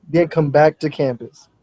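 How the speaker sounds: usual speaking voice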